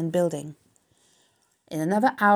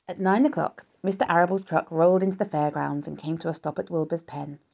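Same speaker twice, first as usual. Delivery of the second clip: ordinary delivery, nothing marked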